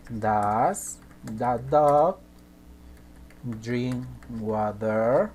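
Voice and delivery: each word stretched out, very slow; very slow dictation. Shown as a demonstration. Teacher dictating the question very slowly to students. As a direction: spell it out slowly for the class. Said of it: "A ver, por ejemplo".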